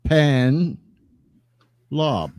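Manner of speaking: long pause after each part: S, Pen, Lob; syllable by syllable. Shown as a demonstration. Host teaches his last name, S, Pen, Lob in separate pieces. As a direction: sound out S, Pen, Lob one piece at a time. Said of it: "Yeah, it's like".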